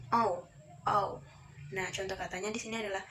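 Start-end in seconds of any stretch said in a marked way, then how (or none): 0.1-1.2 oh said as an "au" glide; teacher modelling "au" with pauses; diphthong demo. Shown as a demonstration. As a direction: sound out oh like "ow", twice. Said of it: "bisa kita baca".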